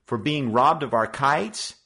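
put-on loud, high indignant boy; mild heated challenge; hot demand. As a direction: fire back in protest. Joe protests.

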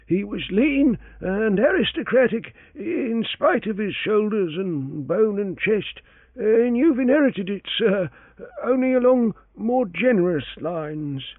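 high, deferential servant voice; humble old valet answering his master; elderly valet Parker. A narrator's reading.

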